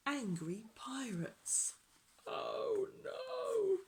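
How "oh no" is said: high, wailing little voice; mock dismay. Storyteller spots angry pirates, high mock dismay. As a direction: squeal in mock alarm.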